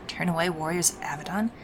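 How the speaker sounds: muttering. Acted. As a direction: mutter it under your breath. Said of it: "Sevelin mutters".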